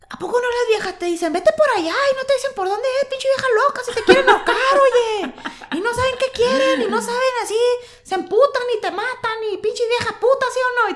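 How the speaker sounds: high-pitched northern Mexican dad impression; northern Mexican accent. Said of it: "este es un acento norteño de mi papá".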